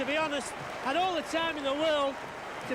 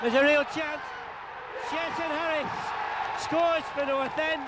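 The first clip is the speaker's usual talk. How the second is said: excited goal call. Real, not acted. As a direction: shout the chance, stretch out the score. Commentator calls a goal.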